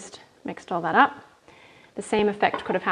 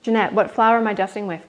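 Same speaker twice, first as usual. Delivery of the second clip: usual speaking voice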